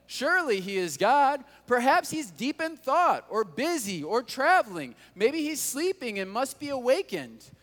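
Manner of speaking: high mocking sarcasm; taunting. Elijah mocking the silent Baal god, high sarcastic taunt. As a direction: sneer it up high, scornfully. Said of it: "Elijah began to taunt them".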